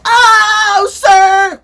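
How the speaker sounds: hammed-up high, loud shriek; over-the-top reaction. Showing how not to react to outfits, hammed-up shriek. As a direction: scream it in exaggerated shock, drawn out. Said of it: "I'm not gonna go".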